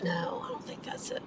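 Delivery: quiet muttering under breath; mumbling to yourself while driving; muttering to myself. Real, not acted. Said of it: "I'm muttering to myself".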